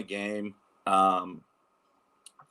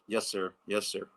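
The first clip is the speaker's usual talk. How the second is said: softer, subdued reply; retold meek obedience; 6 dB quieter than usual. Son answering his dad's stern order, meek and subdued. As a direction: back down quietly, imitating an obedient son.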